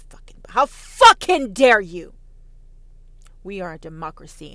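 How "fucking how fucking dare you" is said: louder, bursting with fake outrage; mock outraged shout. Patriot yelling at a commie, mock outraged shout. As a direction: explode at the commie like a furious patriot.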